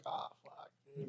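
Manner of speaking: breathless whispered oh fuck; dreading under breath. Retold or imitated. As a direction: whisper it like you just spotted trouble. Dread at line skippers.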